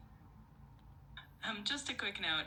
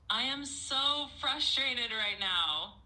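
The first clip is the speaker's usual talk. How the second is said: higher, louder, slow outburst; AI pushed to shout louder; frustrated shout; 7 semitones higher, 6 dB louder, slower than usual. Acted. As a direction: let out frustration at the top of the voice. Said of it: "shout even louder, like you are so frustrated".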